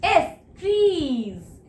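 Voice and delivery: Trees drawn out slowly with a laugh; teacher laughing as she stresses trees; slow stressed plural. Shown as a demonstration. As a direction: lean on trees and stretch it. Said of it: "मैंने लगा दिया".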